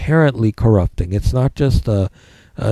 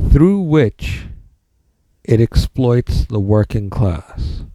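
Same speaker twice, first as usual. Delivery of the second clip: dictating; slower than usual. Shown as a demonstration. Dictating notes to the class.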